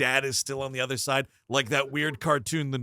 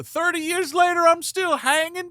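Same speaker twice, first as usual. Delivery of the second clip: high surfer ghost; 7 dB louder than usual. Pretend. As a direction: hang ten from inside the storm.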